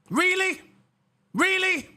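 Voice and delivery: high, incredulous scoff; mock disbelief. Real, not acted. Streamer scoffing at the driver in high mock disbelief. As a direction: act amazed he would dare complain.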